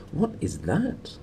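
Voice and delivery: put-on high, soft and slow, a thin old scholar's voice; an old sub-rector's hushed high wonder; sub-rector's question. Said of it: "said the voice of the sub-rector".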